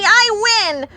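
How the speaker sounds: high, loud claim; triumphant shout. Acted. Pecan racing Hickory, shouting triumphantly. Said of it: "shouted Pecan triumphantly".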